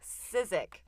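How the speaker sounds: ordinary delivery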